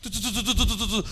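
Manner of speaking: rapid shuddering "du-du-du" stutter; quivering, repeated stammer noise; imitated frightened trembling. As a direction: imitate chattering with fear. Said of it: "무서워가지고 막".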